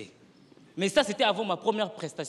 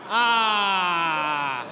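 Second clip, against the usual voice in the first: mock wail; 7 dB louder than usual. Despairing reaction to heckle.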